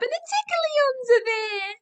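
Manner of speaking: high, loud tickle squeal; playful squeal. Acted. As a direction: squeal the tickle line at toddlers. Tickle punchline of toddler rhyme.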